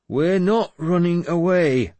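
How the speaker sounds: slow, high, loud refusal; insistent. A narrator's reading. Wounded lord refuses to retreat, insistent. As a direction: insist on it, stretching the words. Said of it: "I insisted".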